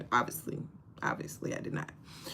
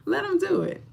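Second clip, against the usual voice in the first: high squeak; 13 semitones higher than usual. Acted. Urging viewers to let men help, high squeak. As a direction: pipe it up high.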